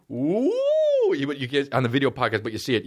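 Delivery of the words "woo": high rising "woo"; imitating the chorus teacher's upward swoop; low-to-high vocal siren. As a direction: glide up into a high hoot. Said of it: "He would go from low to high".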